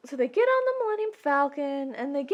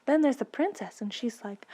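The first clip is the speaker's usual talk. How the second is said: usual speaking voice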